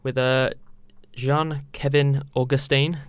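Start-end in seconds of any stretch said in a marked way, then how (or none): 1.1-3.0 slow, careful French pronunciation of the name; French accent. Shown as a demonstration. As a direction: say it the French way, drawn out. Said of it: "excuse my poor French accent".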